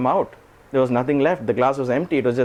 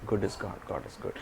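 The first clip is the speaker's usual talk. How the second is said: very soft, lower muttered mantra; muttering; 4 semitones lower, 12 dB quieter than usual. Retold or imitated. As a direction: mutter it to yourself walking off. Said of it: "He kept on muttering".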